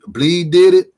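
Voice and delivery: mock stunned outburst; amazed exclamation. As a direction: blurt it out like you can't believe it. Retelling stunned reaction to guest.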